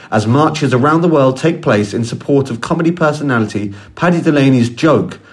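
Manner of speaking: low, measured evening news voice; news anchor read. Acted. Anchor opening the evening bulletin, steady news read. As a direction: announce the story like a TV anchor. Newsreader intro.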